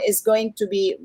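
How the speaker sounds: ordinary talk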